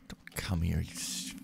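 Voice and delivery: urgent whisper; pleading whisper to the enemy. Real, not acted. Hiding from enemy.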